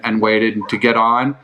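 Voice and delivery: normal talking voice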